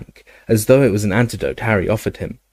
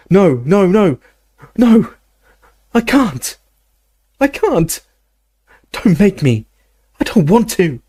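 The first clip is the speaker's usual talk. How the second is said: high, loud cries of refusal; anguished pleading; 9 semitones higher, 3 dB louder than usual. Acted. Dumbledore refusing the potion from Harry, high anguished pleading. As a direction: beg in a high, panicked voice.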